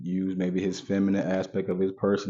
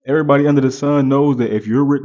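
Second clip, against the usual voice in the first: raised emphatic repeat; 5 semitones higher, 8 dB louder, faster than usual. Real, not acted.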